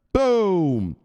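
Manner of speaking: loud, drawn-out falling boom; big celebratory shout; booming exclamation. Pretend. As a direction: punch it out like an explosion. Celebratory catchphrase called out.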